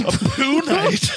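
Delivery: mock disbelief. Joking about Pooh Batman.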